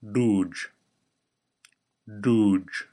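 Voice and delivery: "dooge" with the Klingon D, tongue behind the ridge; "dooge" spoken clearly with a Klingon D; Klingon pronunciation. Shown as a demonstration. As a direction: say "dooge" carefully as a model for learners. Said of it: "try saying".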